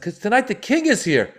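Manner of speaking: high, urgent announcement; frantic promoter. Retold or imitated. Sweating promoter explaining to the comedians, high and urgent. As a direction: stress it like a panicked organizer. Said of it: "He's sweating".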